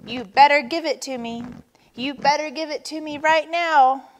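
demanding. A narrator's reading. Turtle ordering Vulture to hand it over, demanding. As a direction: press it like a threat.

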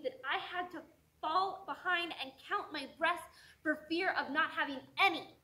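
forceful protest, starting at a shout; angry outburst. Acted. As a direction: hurl it back at the nagging voices. Poet hits back at the elders.

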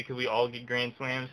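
ordinary delivery

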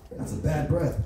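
usual speaking voice

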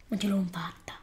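soft, deflated whisper; disappointed whisper. Real, not acted. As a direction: let the defeat come out under your breath. Disappointed after failing to see himself.

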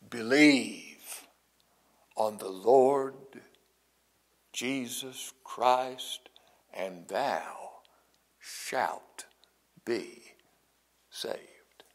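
slowing right down, then whispering the last words; slow fade to a whisper. Acted. Preacher quoting Paul to the jailer, slowing into a whisper. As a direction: let Paul's promise sink into a hush. Preacher quotes Paul's answer.

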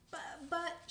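high, loud flustered stammer. Acted. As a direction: stammer in alarm. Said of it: "Charette said".

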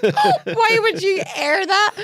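high, loud, slow, laughing dismay; laughing disbelief. Retold or imitated. Herself hearing the ad on radio, laughing dismay. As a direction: squeal it through laughter. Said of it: "I remember just being like".

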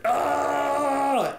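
high Kermit-style yelp; Kermit yell. Shown as a demonstration. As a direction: yelp like Kermit throwing his arms up. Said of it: "Raise your arm up like we're Kermit".

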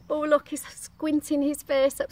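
plain reading voice

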